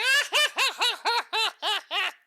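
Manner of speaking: very high, loud cackling demo; hammed-up rapid-fire hyena cackle; exaggerated hyena laugh. Shown as a demonstration. Friend demonstrating their worst laugh, exaggerated cackle. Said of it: "That's, that's me exaggerating".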